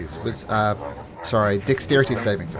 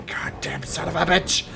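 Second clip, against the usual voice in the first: angry curse in a whispery voice; cursing captain; 3 semitones higher than usual. Acted. Wounded captain after his own man's hit, whispered angry curse. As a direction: curse under the breath, voiceless. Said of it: "He goes".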